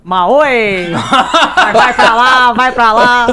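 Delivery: high, loud, laughing game-show host; hammed-up host catchphrases; Silvio Santos impression. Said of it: "como a [name] é mais engraçada".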